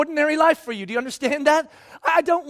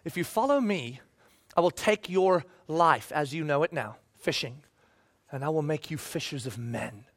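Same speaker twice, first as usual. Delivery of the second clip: a hushed Jesus making a promise; Jesus promising; 8 semitones lower, 7 dB quieter than usual. Retold or imitated. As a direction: lower and much softer, intimate. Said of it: "He goes".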